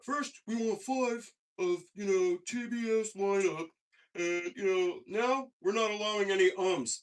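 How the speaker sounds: high, loud, slow official announcement; announcer mock. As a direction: read the rules like a pompous host. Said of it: "they go".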